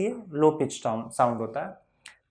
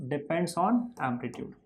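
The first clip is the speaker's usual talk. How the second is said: very slow, softer repeat with a laugh; 7 dB quieter, much slower than usual. Shown as a demonstration. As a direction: say it again while laughing. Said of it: "loudness किस पे depend करता है?".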